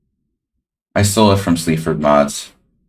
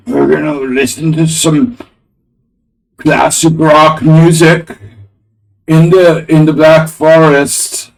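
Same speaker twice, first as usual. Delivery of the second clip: high, loud put-on German accent; hammed-up German, pitched way up; mock German accent; 9 semitones higher, 5 dB louder than usual. Streamer riffing on a hip-hop duo's line, mock German accent. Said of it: "German accent culture theft".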